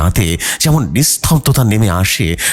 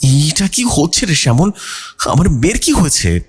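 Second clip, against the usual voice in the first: tearful mother; 8 semitones higher than usual. A narrator's reading. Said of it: "Kalyani ভয়ে কাঁদতে শুরু করলেন".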